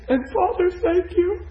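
very high, tearful mock voice; imitating crying prayer. Crying woman thanking the Father, imitated high and tearful. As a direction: squeak it out as if sobbing. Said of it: "Now she's crying".

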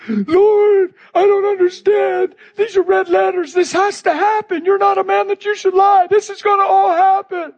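high, tearful pleading to the Lord; crying prayer. Retold or imitated. Preacher retelling his tearful prayer to the Lord, high crying plea. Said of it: "I was losing it".

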